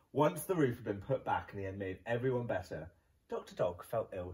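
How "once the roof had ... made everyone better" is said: ordinary delivery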